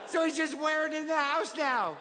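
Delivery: put-on high, singsong little-kid voice; child retelling a storybook. Kid sharing the favorite book mom got, childlike storytelling.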